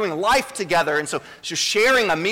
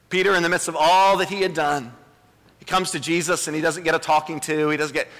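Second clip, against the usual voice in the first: usual speaking voice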